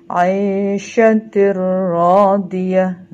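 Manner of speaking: aisyatir radiyah loud and slow, long rolled r; merged reading. Shown as a demonstration. Teacher gives pupils an idgham example, merged recitation. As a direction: recite aisyatir radiyah drawn out, r doubled with no hum. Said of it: "cara membacanya adalah lebur tanpa dengung".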